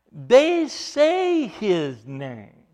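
his name drawn out slowly and loud; stress on his. Shown as a demonstration. Preacher stressing his, not this, slowly. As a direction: lean hard on "his" in "They say his name". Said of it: "They don't say this name".

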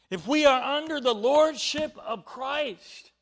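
loud, uplifted pulpit praise; a ringing, confident sermon close; joyful preaching. Real, not acted. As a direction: lift the room with it. Returns to full preaching.

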